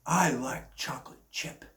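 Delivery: put-on breathy whisper, like a big kid; hushed childlike whisper; whispered Biden imitation. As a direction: mimic him whispering his ice cream order. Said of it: "he looks at everyone and goes".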